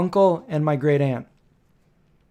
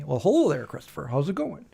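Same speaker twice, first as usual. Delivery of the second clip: lightly imitated uncle, trailing softer; a hint of an uncle greeting his nephew; Uncle Bud; 4 dB quieter than usual. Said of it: "that's what he would say to me".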